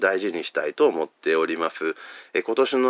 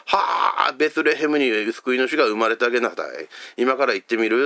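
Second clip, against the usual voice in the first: shepherd in Hakata dialect; 4 semitones higher than usual. Pretend. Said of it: "方言でね".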